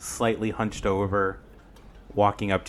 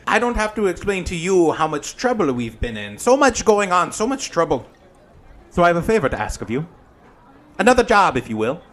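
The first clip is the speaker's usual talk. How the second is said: campy drag queen; 9 semitones higher, 6 dB louder, faster than usual. Acted. GM voices Granny Who.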